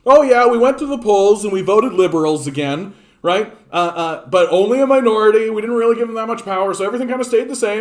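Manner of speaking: smug sped-up voter parody; mock liberal voter. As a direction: parody a self-satisfied voter.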